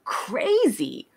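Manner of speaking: crazy drawn out slowly; emphatic CRAZY. Real, not acted. Telling how everyone reacted, emphatic crazy. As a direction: stretch and lift the first syllable of crazy. Stressing others' reaction to pronunciation.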